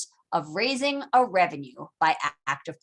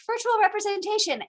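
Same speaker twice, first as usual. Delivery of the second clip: high, pompous mock announcement; pompous mock minister; 11 semitones higher than usual. Grenville to colonists, high pompous mock proclamation. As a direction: proclaim it like a smug official. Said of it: "prime minister who's like".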